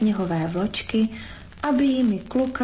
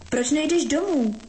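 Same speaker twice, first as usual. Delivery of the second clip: higher, pressing question; insistent demand; 5 semitones higher than usual. A narrator's reading. Winter shakes the boy for an answer, insistent high question. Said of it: "zatřásla chlapcem Zima".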